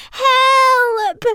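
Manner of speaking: long, high, loud yell; drawn-out panicked shout; cry for help. A narrator's reading. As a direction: scream for help while falling. Falling backwards, calling for help.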